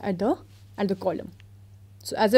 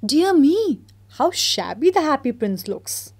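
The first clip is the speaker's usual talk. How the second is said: mayor's exclamation; 5 semitones higher, 4 dB louder than usual. Acted. Mayor voiced in read story.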